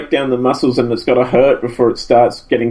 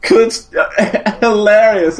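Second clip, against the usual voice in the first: laughing; 8 semitones higher, much slower than usual. Real, not acted. Laughing about Shane's acting.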